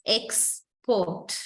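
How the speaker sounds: "Export" said slowly as two separate syllables, Ex, port; split into syllables. Shown as a demonstration. Teacher showing students how the word divides, "Export" in two separated syllables. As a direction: break "Export" with a clear pause after Ex. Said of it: "I can divide the syllables like this".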